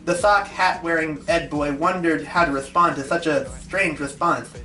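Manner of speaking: ordinary delivery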